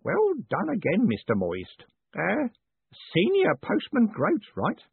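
put-on high, character old man's voice; pleased old Groat. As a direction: fish for confirmation of the new title.

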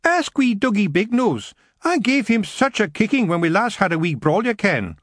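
a wee fighter showing off; sulky, boastful lassie. Acted. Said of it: "Maggie said sulkily".

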